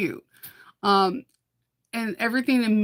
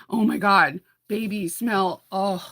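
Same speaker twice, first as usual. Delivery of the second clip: ordinary delivery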